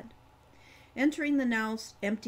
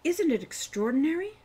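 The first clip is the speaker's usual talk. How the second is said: higher, louder, full of wonder; proud wonder; 7 semitones higher, 6 dB louder than usual. A narrator's reading. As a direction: show off the sight with delight. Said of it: "watching his sister's face".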